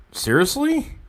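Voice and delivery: sharp high disbelieving seriously; can't believe the news; surprised. Real, not acted. Streamer reacting to a trade rumor, surprised.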